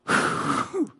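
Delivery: long blown-out breath; bracing exhale before a hard talk; heavy sigh. Retold or imitated. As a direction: blow the air out, apprehensively.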